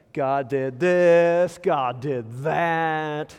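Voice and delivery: slow, drawn-out whiny complaint; the Lamentations author whining to God, slow and sulky. Retold or imitated. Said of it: "he's just whining".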